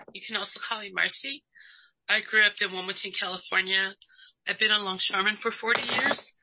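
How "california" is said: ordinary delivery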